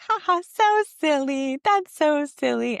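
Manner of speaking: laughing high voice playing with baby; playful laughing. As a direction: laugh along with a giggling baby. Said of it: "I'll be like".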